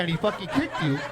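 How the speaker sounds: ordinary talk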